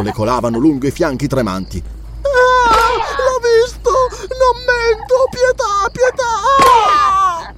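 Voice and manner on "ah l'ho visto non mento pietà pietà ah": put-on very high drawn-out child's wails; agonised pleading. A narrator's reading. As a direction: wail through the pain, begging. Whipped child screams for mercy.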